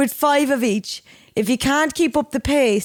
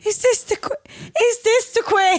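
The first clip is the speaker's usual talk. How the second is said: high-pitched mock echo of the question; teasing high mimicry; mocking imitation; 9 semitones higher than usual. Mimics the other's question back.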